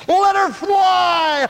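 high, loud, slow cry; exclaiming. Acted. Preacher urging listeners to let go, loud exclamation.

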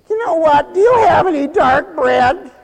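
high, loud asking voice; a demanding customer at the bread line. Retold or imitated.

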